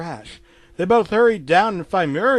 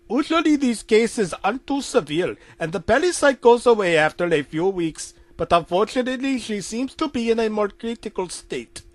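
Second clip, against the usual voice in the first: put-on higher Indian-accented doctor voice; 7 semitones higher, 3 dB louder than usual.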